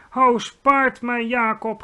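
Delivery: high, loud, stretched-out begging; shrieking plea. A narrator's reading. Giant begs Jacob to spare him, slow high shriek. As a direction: beg for mercy in a loud cry. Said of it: "gilde hij".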